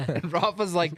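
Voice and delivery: ordinary talk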